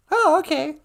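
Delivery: squeaky high puppet voice, loud; high falsetto wife voice. Acted. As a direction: falsetto Michelle puppet greeting.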